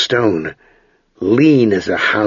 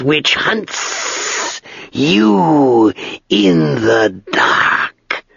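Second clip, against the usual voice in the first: slow, drawn-out, dry dragon voice; great dry voice of an ancient dragon; 5 semitones higher, much slower than usual. Acted. Dragon speaks to Ged.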